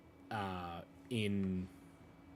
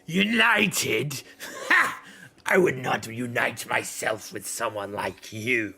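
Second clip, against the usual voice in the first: Ralmar's scorn; 5 dB louder than usual. Acted. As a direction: scoff at being lumped in with them. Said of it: "Ralmar kind of, uh, juts in and goes".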